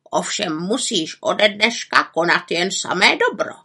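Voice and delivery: raven voice with swooping pitch; croaking raven. A narrator's reading. Raven sets the witch a condition, croaking. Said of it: "krákal Abraxas".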